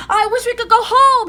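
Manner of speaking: high, loud shouted wish; shouting. A narrator's reading. Jack wishing the treehouse home, shouted. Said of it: "he shouted".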